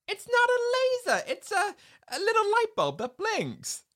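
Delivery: high, squeaky mocking voice; mocking Woody. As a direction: belittle the toy laser. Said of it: "Woody goes".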